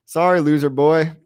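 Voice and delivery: normal talking voice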